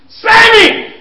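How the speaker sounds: full high scream of the name. Shown as a demonstration. Parent finally screaming for the child. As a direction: yell the name at the top of your voice. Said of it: "when you keep on screaming at them".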